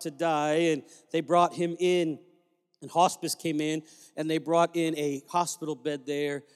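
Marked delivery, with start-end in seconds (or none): none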